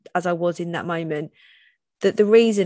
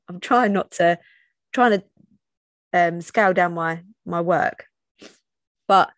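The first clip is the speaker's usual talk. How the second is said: ordinary talk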